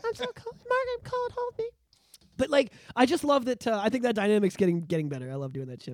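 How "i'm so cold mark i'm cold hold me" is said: high, soft mock whimper; high breathy plea to Mark; clingy little girl. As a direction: play a cold clingy girl, softly. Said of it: "Sounds just like that when he says that".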